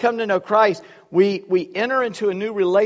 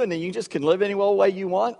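usual speaking voice

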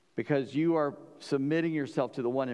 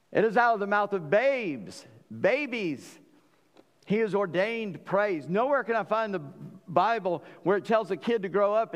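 preaching at full force; 7 semitones higher than usual. Acted. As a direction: preach it hard to the congregation.

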